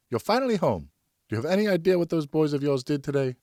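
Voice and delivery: a mother holding back anger; upset wife. Acted.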